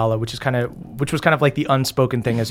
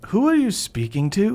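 higher-pitched, puzzled question; lightly voiced Erdan; 9 semitones higher than usual. Erdan overhearing Moonshine talk to a voice, higher puzzled query. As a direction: ask it pitched up, confused.

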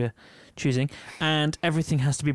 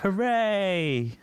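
higher-pitched drawn-out hooray; celebratory cheer; 8 semitones higher than usual. Pretend. Co-host celebrates reaching ten episodes, high playful cheer. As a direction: let out a long, high cheer.

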